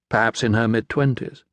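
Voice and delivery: ordinary delivery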